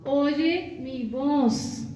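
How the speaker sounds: slow pleading. Acted. God's voice pleading to listen.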